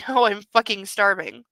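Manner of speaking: normal talking voice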